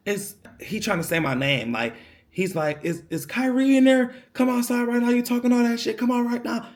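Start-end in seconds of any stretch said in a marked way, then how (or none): 2.8-6.7 angry man at door. Retold or imitated. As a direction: imitate the angry stranger calling out.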